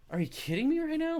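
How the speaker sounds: a high, exasperated are-you-serious; incredulous. Retold or imitated. Hiker, in disbelief at women fleeing a garter snake, incredulous and high.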